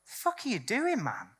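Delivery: incredulous. Retold or imitated. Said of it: "and it's like".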